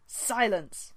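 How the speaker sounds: a loud furious order; hissed command. A narrator's reading. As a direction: cut her off hard. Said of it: "Firestar hissed".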